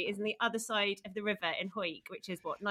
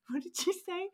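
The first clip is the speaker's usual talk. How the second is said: higher, puzzled mock voice; imitating a puzzled friend; 9 semitones higher than usual. Retelling her friend's hungover puzzlement, mock-puzzled voice. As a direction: play the pensive friend asking back. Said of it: "looks really pensive and goes".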